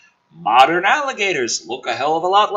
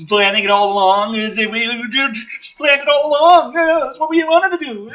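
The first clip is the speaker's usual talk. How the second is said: sarcastic mockery; 7 semitones higher, 4 dB louder than usual. Real, not acted. Sarcastic mocking of the plot.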